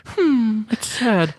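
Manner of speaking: mock sad. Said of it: "a really exaggerated, like, sad frown".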